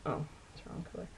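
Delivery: quick whispered mutter to herself; whispered aside. Real, not acted. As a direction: whisper it under your breath, fast. Muttered aside while doing makeup.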